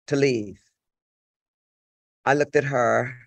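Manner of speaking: ordinary talk, nothing marked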